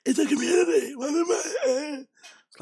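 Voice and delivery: mocking how he talks on the show; mock weird voice. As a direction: parody of a strange affected voice. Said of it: "Why is he talking like that?".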